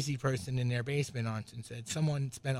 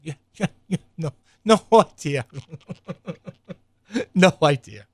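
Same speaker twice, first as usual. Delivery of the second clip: louder, laughing no idea; laughing sarcasm; 3 semitones higher, 10 dB louder than usual. Real, not acted. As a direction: scoff through a laugh.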